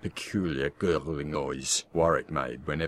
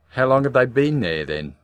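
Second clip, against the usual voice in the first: challenging retort; 7 semitones higher, 8 dB louder than usual. Acted. Wife challenges husband.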